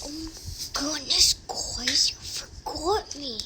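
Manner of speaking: soft whispery little character voice; Peck imitation. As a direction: breathe it out small and hushed. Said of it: "do you want me to do my imitation of Peck?".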